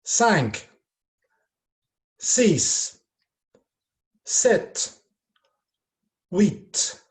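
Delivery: each number called out loud and clear; loud teacher counting drill. Shown as a demonstration. Teacher calling numbers loudly for pupils to copy. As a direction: count aloud for the class to repeat. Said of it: "do écouter et répéter".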